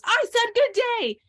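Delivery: high, loud, drawn-out mock outburst; imitated mad child. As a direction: act out a child's indignant dismissal, slowly and loudly. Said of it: "so mad at me and Isaac".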